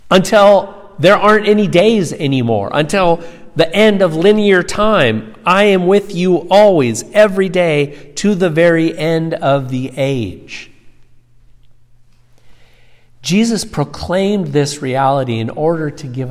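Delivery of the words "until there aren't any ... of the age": raised, loud sermon climax; emphatic preaching. Real, not acted. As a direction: preach it with rising conviction, easing at the end.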